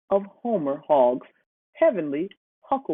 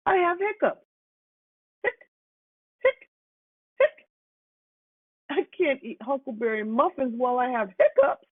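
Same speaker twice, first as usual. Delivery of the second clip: Hannah with hiccups; 9 semitones higher than usual. A narrator's reading.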